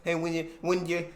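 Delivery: ordinary talk, nothing marked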